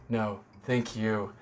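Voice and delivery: a mock tender thank-you; slow, soft, sincere thanks. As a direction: draw it out gently and earnestly. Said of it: "She's like".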